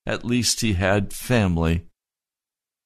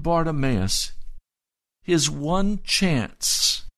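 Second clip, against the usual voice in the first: impassioned preaching; 9 semitones higher than usual. Acted. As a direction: build the drama, lean on "one chance". Preacher builds to climax.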